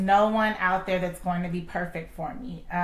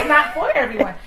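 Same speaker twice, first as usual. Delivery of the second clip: mock retort; 6 semitones higher, 6 dB louder than usual. End of same retort.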